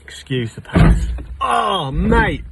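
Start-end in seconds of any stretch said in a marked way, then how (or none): 1.4-2.4 startled cry. Real, not acted. Bath hits him in stomach.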